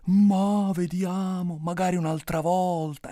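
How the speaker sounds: put-on evasive old storyteller. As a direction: put it off with long, stretched vowels. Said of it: "lui mi rispondeva sempre".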